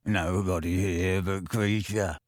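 low, slow, gloating croak; gleeful elf. Acted. Elf gloating to Harry, low gleeful croak. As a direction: savour every word. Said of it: "said the elf gleefully".

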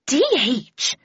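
a touch of cross owner scolding. Acted. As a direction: snap the pet's name. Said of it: "she said crossly".

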